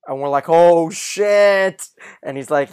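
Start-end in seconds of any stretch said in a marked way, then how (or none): 0.5-1.9 loud, high fan reaction; excited outburst. Retold or imitated. Host recalling viewers' reaction to Tommy Shelby, loud and thrilled.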